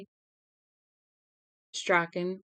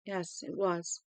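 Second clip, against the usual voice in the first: softer, quiet and level; eerily calm; 7 dB quieter, slower than usual. Acted. Father confirming the fire to his wife, eerily calm. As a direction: say it hollow and still. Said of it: "He seemed eerily calm".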